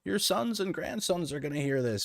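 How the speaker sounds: high-pitched mock grandma scolding; scolding conservative grandmother. An old conservative grandmother scolding him for swearing, high scolding voice. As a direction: put on a high, fussy old-lady voice. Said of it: "getting scolded by, like, you know, an old conservative grandmother".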